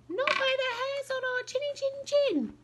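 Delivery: very high piggy squeak; tiny pig squeaking its refusal; squeaky little pig. A narrator's reading. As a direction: pitch way up like a tiny frightened pig. Pig answering wolf.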